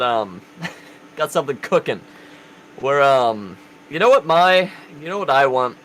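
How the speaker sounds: ordinary talk, nothing marked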